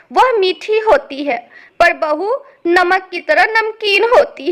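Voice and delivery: acted tearful grievance. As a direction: pour out the hurt loudly. रोना बंद करो.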